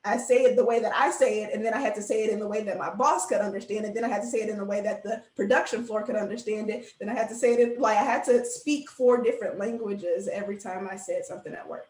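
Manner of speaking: normal talking voice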